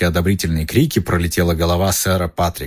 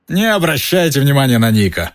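shouting; 6 semitones higher than usual. Acted. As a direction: shout it up from the floor. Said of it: "прокричала голова".

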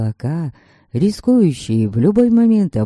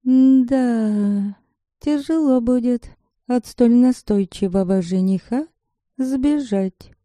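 high, mocking inner voice; a teasing inner voice, lifted high; voice of conscience; 7 semitones higher, slower than usual. A narrator's reading. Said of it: "насмешливо шепнул внутренний голос".

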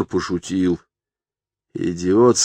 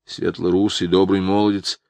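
usual speaking voice

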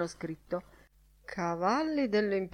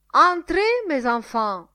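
loud, high-pitched call; hearty shouted invitation, pitched high; shouting; 9 semitones higher, 10 dB louder than usual. A narrator's reading. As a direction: cry it out to summon them in. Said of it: "gridò Lenihan".

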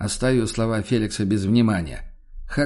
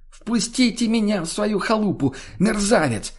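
high, angry cry for entry; shrieked demand; 10 semitones higher than usual. A narrator's reading. Woman knocking demands to be let in, shrieking. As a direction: demand shelter in a squealing pitch. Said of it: "потребовал голос".

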